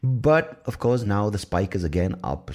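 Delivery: normal talking voice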